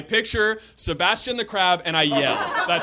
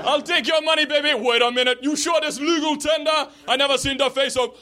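put-on Jamaican accent; 8 semitones higher than usual. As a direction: rant like a Jamaican cab driver. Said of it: "admiring my Jamaican accent".

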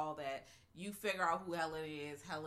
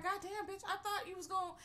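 exasperated Helen; 13 semitones higher than usual. Retold or imitated. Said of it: "She's like".

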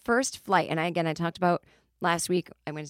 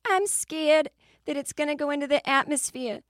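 higher, hammed-up Boston accent, scared; put-on Boston accent; 9 semitones higher than usual. Boston passenger admitting fear to the pilot, mock Boston accent. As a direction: imitate a nervous Boston woman, Boston accent. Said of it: "just imagine a Boston person".

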